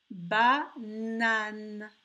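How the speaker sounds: "Banane" in two loud, separated syllables; slow dictation of "Banane"; word sounded out. Shown as a demonstration. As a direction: split "Banane" into ba, nane, holding the n. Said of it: "il y a deux fois le son N".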